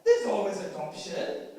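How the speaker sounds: lightly voiced brash Bradford teenager, laughing at her own jokes; a hint of a sassy nineteen-year-old from Bradford; Bradford teen persona. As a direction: play the cheeky Bradford girl. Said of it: "the actual way that I talk is like this".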